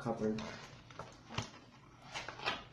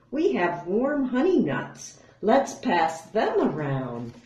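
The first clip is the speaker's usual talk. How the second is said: higher, louder chirpy gopher; small critter voice; 5 semitones higher, 5 dB louder than usual. Acted. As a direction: pipe it up eagerly. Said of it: "gopher and mole speak".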